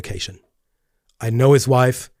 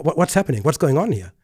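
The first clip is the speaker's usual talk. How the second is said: normal talking voice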